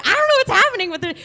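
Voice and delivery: very high, rapid babble; flustered panic. Retold or imitated. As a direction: rattle it out in mock alarm. Panicked reaction, retold.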